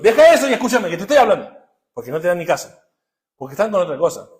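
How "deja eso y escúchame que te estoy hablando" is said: a loud, higher mock scold; scolding parent. Parent telling a distracted child to listen, mock scold. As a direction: call the child off what they're doing. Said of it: "estamos siempre con".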